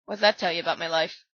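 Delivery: usual speaking voice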